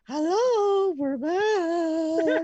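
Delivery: high, loud announcer hello; mock show greeting. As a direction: belt it like a returning host. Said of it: "and be like".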